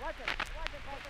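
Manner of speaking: high, frantic shouting in shock; panicked shouting. Real, not acted. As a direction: cry out in sudden horror. Airship explodes during live broadcast.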